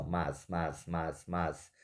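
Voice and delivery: "mas" repeated softly and low, each as one syllable; murmured even repeats of "mas", never split; one-syllable demo. Shown as a demonstration. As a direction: show "mas" as a single beat, quietly. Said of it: "é como se a gente lê isso assim".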